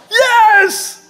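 high loud triumphant cheer; cheering yes. Retold or imitated. Wife celebrating daughter's potty success, loud cheer. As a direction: celebrate like a proud mom.

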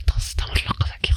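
whispered fast, like sharing a mad secret; creepy whisper. Pretend. Creepy aside to the listener.

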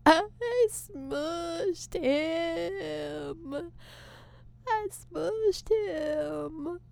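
soft, slow, tearful repeat; quiet horrified whimper of guilt; stunned grief. Retold or imitated. After stepping on a caterpillar, soft tearful disbelief. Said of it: "I would say it that way on stage".

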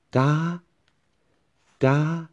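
high level ga. Shown as a demonstration. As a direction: pitch ga up high and hold it. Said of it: "just go high".

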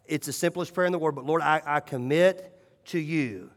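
a soft, earnest prayer said in the quiet of the morning. Shown as a demonstration. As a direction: pray it quietly, sincerely.

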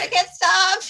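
giggling confession; laughing. Retold or imitated. Kid admitting, laughing.

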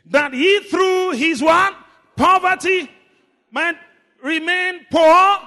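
high, loud, stretched-out proclamation; full-force preaching. Real, not acted. Preacher making the congregation say rich, full-force preaching. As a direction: rouse the crowd to repeat it.

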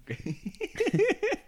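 quietschendes, hohes Auflachen; hoch und gepresst loslachen; hohes Lachen. Real, not acted. Lacht weiter über Rülpser. (quiekendes Lachen)

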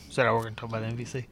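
fast, soft aside; hurried, under-projected question; quick undertone. Real, not acted. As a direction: toss the question out quickly and quietly. Question missed, listener asks what.

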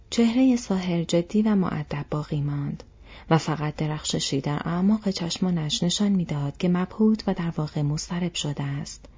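usual speaking voice

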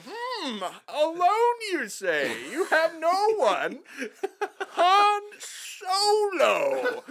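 high, loud, drawn-out mock realization; hammed-up pondering officer. Host playing Imperial recruiter naming Han, hammed-up pondering. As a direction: ponder it grandly, stretching the name out slowly. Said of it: "kinda strokes his chin. He's like".

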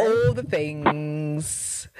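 slow drawn-out All the things; like Amanda. Retold or imitated. Mimicking Amanda's catchphrase, very slow. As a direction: stretch All the things like your friend. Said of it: "say it like Amanda".

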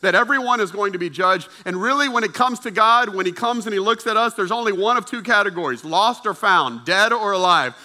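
loud, high, driving proclamation; forceful preaching. Real, not acted. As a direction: hammer the warning home to the congregation.

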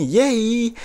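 a quick squeal of relief; gleeful yay. Real, not acted. Relieved he's not the supervisor.

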